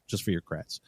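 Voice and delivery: trailing quiet repeat of "just for your creds"; quiet echo. Real, not acted. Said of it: "It's just for your creds".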